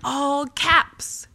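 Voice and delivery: loud, high shouted burst; all-caps shout. Shown as a demonstration. Blogger acting out a writing device, all-caps shout. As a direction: shout it as if typed in capitals. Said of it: "tricks of the trade that we use to convey tone".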